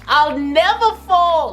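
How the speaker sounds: high, drawn-out diva sass; sassy diva taunt. Acted. Vlogger mocking haters waiting for her downfall, high sassy taunt. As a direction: strut through every bitch like a queen.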